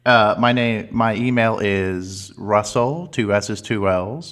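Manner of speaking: slow, loud, over-polite caller spelling things out; schmaltzy phone voice. Acted. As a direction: lay the customer-service charm on thick. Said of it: "that was the phone voice cranked up as high as it can possibly go".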